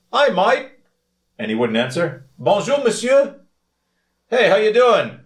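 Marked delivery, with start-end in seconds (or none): 0.1-0.7 much higher, bright hawker call; vendor's Aussie greeting. Retold or imitated. Vendor hailing a tourist in the market, high and cheery. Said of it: "he'd go". 2.4-5.2 much higher, louder hawker calls; vendor greetings. Retold or imitated. Vendor calling greetings to passing tourists, high and loud. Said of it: "ten different greetings in ten languages".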